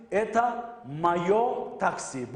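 slow, spaced-out drill phrase; very slow model phrase. Shown as a demonstration. Teacher modelling a Russian phrase, slow and spaced. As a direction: lay each word out slowly for the learners. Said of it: "Bu benim taksim".

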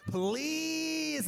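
very high, drawn-out whiny plea; hammed-up high-pitched pleading; mock begging. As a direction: imitate a tearful beg, stretching the vowel way up. Retold crying woman begs for sex.

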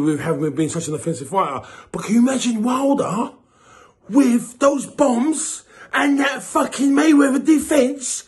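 usual speaking voice

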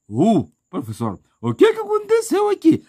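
high, squeaky voice of a puzzled pupil; confused student. Pretend. Student calling out to the teacher, high and squeaky.

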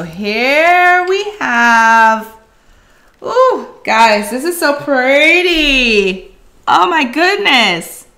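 delighted gushing. Real, not acted. Admiring finished craft piece.

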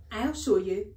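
loud, plucky schoolboy; brave piping boy. A narrator's reading. Pupil coming to teacher's rescue, piping up bravely. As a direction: pipe up boldly. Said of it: "piped up the brave Nigel again".

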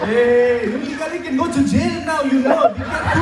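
loud, high, laughing teasing voice; mock teacher warning. Teacher teasing the eighteen-year-old, loud laughing mock warning. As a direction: tease the birthday boy loudly. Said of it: "he's like".

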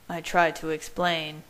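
ordinary delivery, nothing marked

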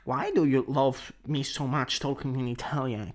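ordinary delivery

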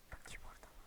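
breathless whisper, barely audible; hiding whisper. Real, not acted. Hiding from the monster, a tense whisper. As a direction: whisper so it won't hear you.

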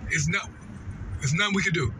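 ordinary delivery

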